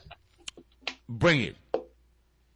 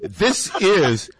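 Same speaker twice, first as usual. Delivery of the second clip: radio announcer; 8 semitones lower, 3 dB louder than usual. Pretend.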